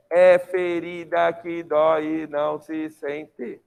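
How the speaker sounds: slow, even, every syllable separated; grammatical syllable count. Shown as a demonstration. Teacher scanning the line for the class, every grammatical syllable evenly separated. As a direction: sound out "é ferida que dói e não se sente" syllable by syllable. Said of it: "Isso é sílaba gramatical".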